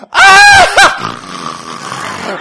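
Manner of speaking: loud burst of snorting laughter; imitated outrageous laugh. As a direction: blurt a huge laugh, mimicking a friend. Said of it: "when you get her laughing, she'd be like".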